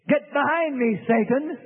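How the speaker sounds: mild rebuke. Retold or imitated. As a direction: push the request away.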